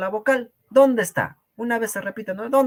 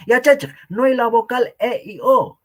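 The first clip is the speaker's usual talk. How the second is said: reads the student's line louder and higher, teasing; mock reading of student's message; 6 semitones higher, 6 dB louder than usual. Teacher reads out a student's message, mockingly. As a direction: parrot the student's claim back in a mocking voice. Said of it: "lo que nos escribe".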